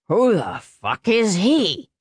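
put-on slow slurred drunken demand; a bleary man squinting and demanding; drunk father.

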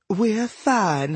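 sweet, high, lilting lady's voice; smitten hippo goddess. A narrator's reading. Hippo goddess answers the dwarf with delight, fond coo.

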